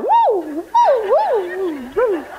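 high repeated woo howls; dog-like whooping. Pretend. Host mimicking the barking church with high woo howls. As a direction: howl like a happy dog.

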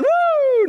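high, drawn-out "woo"; joyful high-pitched hoot; celebratory whoop. Real, not acted. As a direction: let out a long high whoop. Celebrates end of age creams.

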